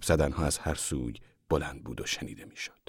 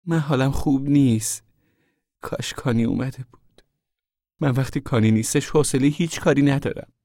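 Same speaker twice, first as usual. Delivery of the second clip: higher-pitched, sullen complaint; sulky grumbling; 8 semitones higher than usual. A narrator's reading. Rosasharn, feeling unwell without her husband, complains to mother, sulky. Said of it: "با اخم و تخم گفت".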